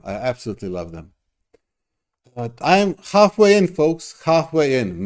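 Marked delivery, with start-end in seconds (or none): 2.3-5.0 excited announcement. Real, not acted. Excitedly announcing progress to viewers.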